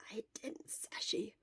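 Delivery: hoarse sick whisper, barely any voice; Shota's voice. Acted. Shota whispering weakly to his friend from the sickbed. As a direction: whisper it like a sick man worn out.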